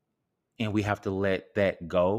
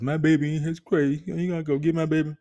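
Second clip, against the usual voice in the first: mock weepy owner; 8 semitones higher, 4 dB louder than usual.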